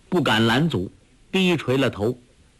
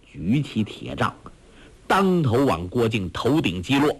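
usual speaking voice

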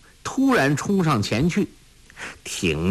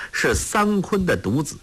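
plain reading voice

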